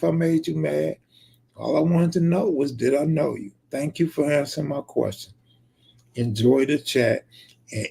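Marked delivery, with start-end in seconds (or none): none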